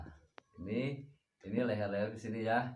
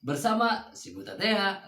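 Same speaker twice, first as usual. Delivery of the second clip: presenter intro; 7 semitones higher, 6 dB louder than usual. Acted. Channel intro greeting.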